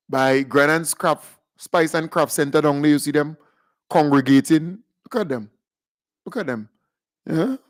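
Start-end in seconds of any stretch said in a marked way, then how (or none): none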